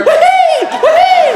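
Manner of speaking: very high squealing whoops; Mario whoop. Acted.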